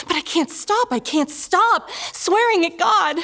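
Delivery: high, distraught acted wail; desperate confession. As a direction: cry out that you can't control it. Acts out helpless compulsion.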